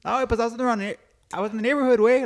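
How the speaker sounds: high, whiny mock buddy voice; Javi impression. Buddy explaining to his friend, high put-on voice. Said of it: "this dude actually talks like this".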